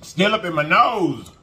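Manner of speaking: slow, high, loud exclamation; real wasabi reaction. Real, not acted. Eater with wasabi still in her nose, drawn-out high exclamation. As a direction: stretch it out loudly through the burn.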